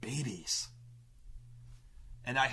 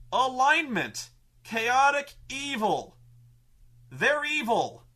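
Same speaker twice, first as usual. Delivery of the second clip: higher and louder, slow on chaotic evil; mock rules-lawyer citing the book; 8 semitones higher, 6 dB louder, slower than usual. Player quoting the handbook entry, loud and triumphant. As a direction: read it out as final proof. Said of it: "points to the orc entry and goes".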